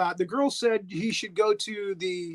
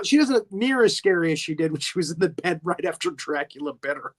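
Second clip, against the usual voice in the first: ordinary talk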